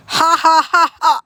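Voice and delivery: high, loud burst of laughter; big high laugh. Pretend. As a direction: cackle loudly at him. Laughs at hiccupping cat.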